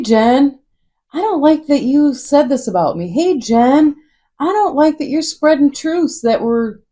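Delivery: high, sugary mock voice of two-faced acquaintances; mocking fake friends. Fake friends sweet-talking Jen to get back in, in a high mock voice.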